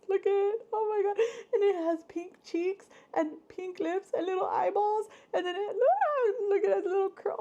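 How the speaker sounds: very high, near-crying gushing; teary squeals. Real, not acted. Vlogger showing the pickle's face, tearfully squealing. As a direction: squeal through happy tears. Overwhelmed by cute gift.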